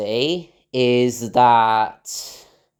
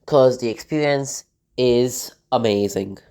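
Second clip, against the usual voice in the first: ordinary talk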